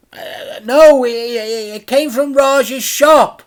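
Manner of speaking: a caught-out fib; flustered boy. A narrator's reading. Frank flustered.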